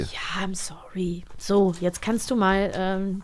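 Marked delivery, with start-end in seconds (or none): 0.0-1.2 soft unvoiced sorry, much quieter; a hushed sheepish sorry; whispered apology. Real, not acted. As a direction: breathe the apology under the voice.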